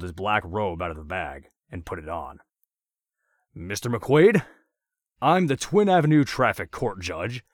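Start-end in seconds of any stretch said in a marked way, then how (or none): 3.5-4.5 higher, louder mock-official proclamation; grand announcement. A narrator's reading. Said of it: "Andrews announced". 5.2-7.4 "Twin" pushed up high and loud, proud declaration; pompous announcement. A narrator's reading. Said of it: "Andrews announced".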